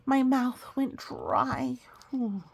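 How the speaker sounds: slow, whispered nerves; slow anxious whisper; nervous whisper. A narrator's reading. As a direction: breathe it out scared and dry. Nervous moment in story.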